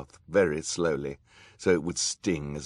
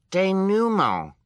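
put-on very high, loud little-child voice; correcting toddler; 10 dB louder, slower than usual. A narrator's reading. Toddler Sunny correcting the villain's French, high loud piping. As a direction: pipe it up slowly, very high. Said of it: "Sunny corrected".

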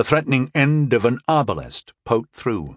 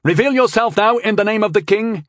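high, commanding military order; raised-pitch soldier's challenge; best military voice; 10 semitones higher, 4 dB louder than usual. A narrator's reading. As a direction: proclaim it like an officer. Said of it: "said in his best military voice".

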